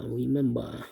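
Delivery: ordinary talk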